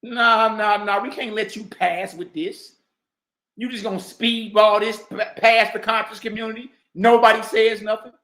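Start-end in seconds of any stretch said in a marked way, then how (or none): none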